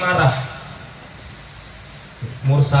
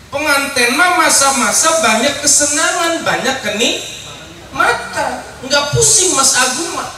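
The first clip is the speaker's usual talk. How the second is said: teasing; 6 semitones higher than usual. Real, not acted. Teasing the groom.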